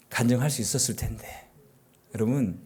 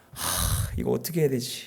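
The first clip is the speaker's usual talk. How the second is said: worried sigh to self; 5 dB quieter than usual. Retold or imitated. Pastor voicing own worried moment.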